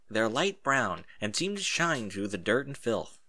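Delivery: usual speaking voice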